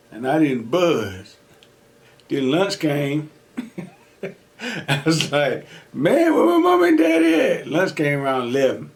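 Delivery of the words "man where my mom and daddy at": put-on much higher, a scared kid whining; high whiny plea. Jailed kid whines high for his mom and daddy. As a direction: beg for your parents in a high voice.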